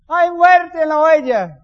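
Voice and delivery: muerte drawn out high and loud in mock horror; family teasing the pastor's cooking with a loud, slow, high cry; mock family shout. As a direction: wail that there's death in the pot, slowly. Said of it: "Mi familia grita".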